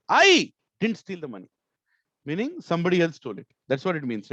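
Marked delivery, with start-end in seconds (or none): 0.1-1.5 "I" very loud and high, rest dropping away; a loud, emphatic "I" to open; stress on I. Shown as a demonstration. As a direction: belt out "I", then ease off. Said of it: "very loudly".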